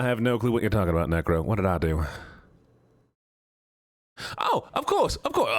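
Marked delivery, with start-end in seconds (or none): 4.4-5.5 squeaky high. Acted.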